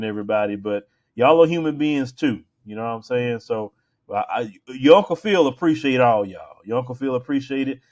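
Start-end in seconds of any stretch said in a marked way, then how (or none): none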